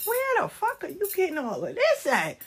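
high-pitched incredulous outburst. Acted. Reacting to someone's wild made-up scenario, incredulous. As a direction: question it in a high, disbelieving voice.

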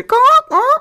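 high, loud squawking caw; chicken squawk. Retold or imitated. Caller imitating chickens in the support lady's background, high squawk.

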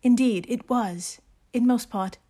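hammed-up British accent, measured reading; amateur British accent with deliberate pauses; Jane Austen voice. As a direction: read it like a genteel British lady novelist. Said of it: "in your best Jane Austen voice".

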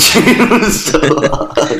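high, drawn-out words through laughter; laughing. Real, not acted. Friend cracks up over the lame co-counselor, laughing.

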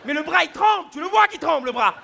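high, loud complaining rant; loud mock complaint about a trembling arm; comic outrage. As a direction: pitch it up and push the volume like a suffering boyfriend.

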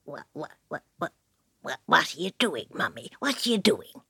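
low creature voice; stammering low little creature; tiny critter. Acted. Creature asks mother.

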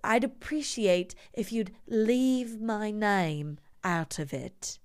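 slow, over-pronounced mock-posh English; cut-glass English accent. Host as the patient asking to be left alone, cut-glass English accent. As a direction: draw it out in royal-family English. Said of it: "it's called a cut glass English accent".

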